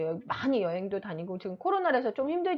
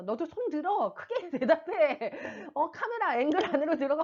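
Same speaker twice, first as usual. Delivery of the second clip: frustrated mom; 6 semitones higher than usual. Retold or imitated. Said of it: "막 이렇게 얘기 저도 합니다".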